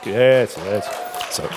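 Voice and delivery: usual speaking voice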